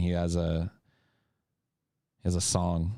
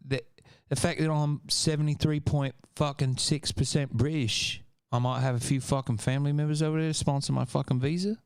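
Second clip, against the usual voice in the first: higher pitched mock British accent; 7 semitones higher than usual. Streamer musing on moving to London in a mock British accent. Seventy-three point fucking six percent British.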